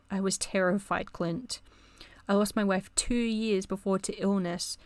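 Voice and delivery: usual speaking voice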